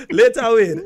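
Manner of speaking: said through laughter; laughing. Real, not acted. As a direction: laugh the words out. Laughing while saying goodbye.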